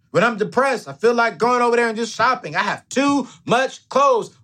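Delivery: high mock voice of a shopper; imitating the women. Host voicing women who regret buying clothes, high mock voice. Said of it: "every single woman that came to me".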